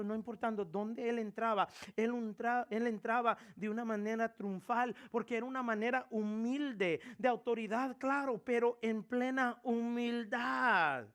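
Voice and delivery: loud sermon close, last words slow; rising pulpit proclamation; preaching climax. Acted. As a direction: build to the point and stretch the end.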